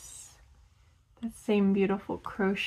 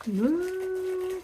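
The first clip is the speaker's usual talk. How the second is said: excited ooh; 10 semitones higher than usual. Real, not acted. Opening a package from France.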